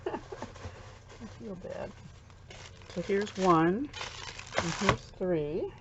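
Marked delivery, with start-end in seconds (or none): 0.1-1.9 slow, sheepish whisper; a small guilty whisper, much softer; whispered guilt. Real, not acted. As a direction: breathe it out, embarrassed. Guilty repeat after unexpected gift.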